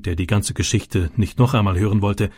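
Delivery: usual speaking voice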